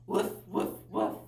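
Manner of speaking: airy little dog woofs; breathy puppy woof. Shown as a demonstration. Teacher showing children a puppy's woof, breathy. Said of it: "your animal sounds".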